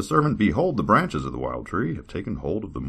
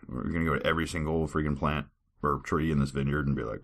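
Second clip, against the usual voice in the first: normal talking voice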